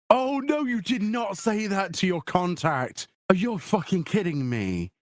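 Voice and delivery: scandalised, stretching the words out; appalled disbelief. Real, not acted. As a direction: react as if your friend just blundered badly. Appalled at friend's awkward confession.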